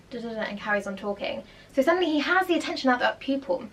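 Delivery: usual speaking voice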